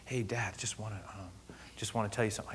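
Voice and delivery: soft, hesitant murmur; discreet aside. Retold or imitated. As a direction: break it gently to your dad, voice dropped. Son quietly breaks news to dad.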